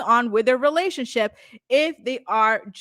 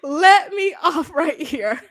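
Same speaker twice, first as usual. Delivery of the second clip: a laughing, slowed plea, louder; laughing mock plea; 5 semitones higher, 6 dB louder, slower than usual. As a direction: beg to be let out while laughing. Imagined reply to the insult.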